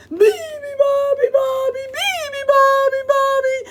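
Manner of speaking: loud high roar. Acted. Animals roar the song. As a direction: roar it together. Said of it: "they roared".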